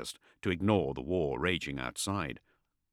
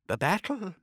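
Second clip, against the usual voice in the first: nervous; 13 semitones higher than usual. A narrator's reading. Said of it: "he swallowed".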